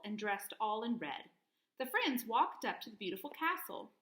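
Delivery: plain reading voice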